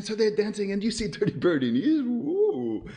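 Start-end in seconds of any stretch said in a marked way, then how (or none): 2.0-2.9 rising cartoon "ooh" of wonder; awed ooh. Dirty Birdie watching the dance, awed rising ooh. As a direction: let the ooh slide upward.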